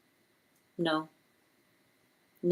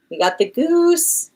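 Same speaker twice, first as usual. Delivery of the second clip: high, slow, triumphant announcement; excited reveal; 10 semitones higher, 6 dB louder, slower than usual. Real, not acted. Reader announcing the guessed card to viewers, excited. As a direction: savour the win, drawn out and high.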